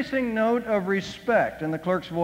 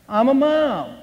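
higher, louder proud declaration; indignant snap; 5 semitones higher, 5 dB louder, slower than usual. Retold or imitated. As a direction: throw it back at the clerk with pride. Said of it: "snapped Emily".

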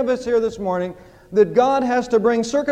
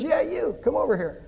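talking bird; 2 semitones higher, 5 dB quieter than usual. Acted. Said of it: "Bird says".

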